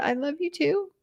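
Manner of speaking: softly, barely above a breath; very quiet. Retold or imitated. Narrator voices the wife answering her husband, very quiet. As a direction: say it small and hushed. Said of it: "very, very quiet".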